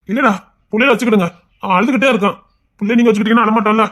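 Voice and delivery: loud, quick insistent plea; urgent pleading. Acted. Younger brother urging the elder to keep the kids, loud.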